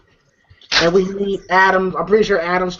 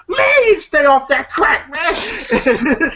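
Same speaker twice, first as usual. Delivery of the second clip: imitated rebuke; 7 semitones higher, slower than usual. Acted rebuke between movie characters.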